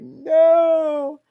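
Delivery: one high, loud cry of no; high loud despairing no; retold anguished no. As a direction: wail the no in dismay. Hearing it all go down.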